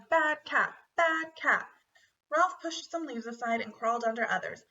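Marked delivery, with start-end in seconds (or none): 0.1-1.7 high, louder bad cat shouts; scolding shout. A narrator's reading. Lena scolding the cat across the camp, shouting. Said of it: "Lena shouted".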